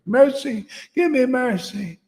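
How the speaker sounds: high, whiny plea for mercy; mock begging disciple. Disciple mocked pleading for mercy, high and whiny. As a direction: beg like a lost follower.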